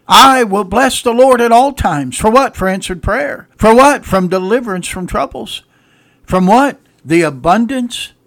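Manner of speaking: loud, high preacher's proclamation; emphatic preaching. Acted. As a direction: ring out each question, then answer it. Preaches in call and answer.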